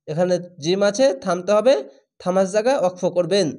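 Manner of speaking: ordinary talk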